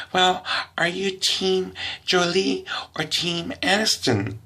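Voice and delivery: high, earnest mock girl voice; earnest girl question. Girl quizzing the comedian on celebrities, high earnest tone.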